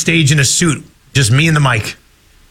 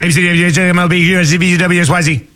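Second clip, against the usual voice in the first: fast alphabet; much faster than usual. Shown as a demonstration. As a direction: rattle it off at top speed. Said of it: "read the alphabet really quickly".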